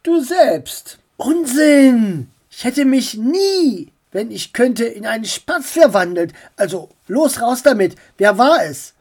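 a hint of an indignant uncle; uncle's voice.

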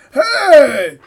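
loud high yell; shout. Acted. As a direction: cry out in alarm. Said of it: "schrie der Fahrer".

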